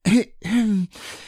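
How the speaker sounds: loud mock throat clearing; a little cough before the poem. A narrator's reading. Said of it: "Pooh gave a little cough".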